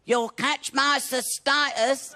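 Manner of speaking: high, slow put-on female voice; hammed-up high warning from a woman; woman's voice imitation. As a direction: draw it out high, as the girlfriend. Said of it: "She said".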